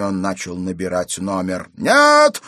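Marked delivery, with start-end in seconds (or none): 1.8-2.4 very high, loud explosive refusal; roared. A narrator's reading. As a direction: roar it to stop them. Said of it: "рявкнул Пельт". (яростно рявкнуть)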